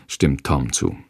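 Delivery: usual speaking voice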